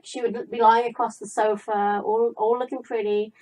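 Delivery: usual speaking voice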